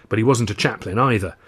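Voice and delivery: usual speaking voice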